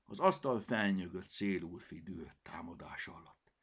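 ordinary delivery, nothing marked

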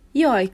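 snatch the hand back with a yelp; sharp yelp of pain. Acted. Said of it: "kapta vissza kezét".

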